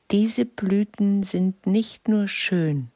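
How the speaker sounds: high, slow fairy queen voice. A narrator's reading. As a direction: speak like a gentle queen. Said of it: "sprach sie".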